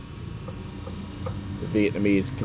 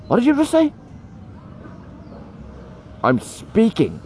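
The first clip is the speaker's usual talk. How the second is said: indignant mock outrage; 14 semitones higher than usual. Teacher snapping at a student who interrupted, indignant. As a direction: play the offended teacher, pitched up. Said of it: "I was like".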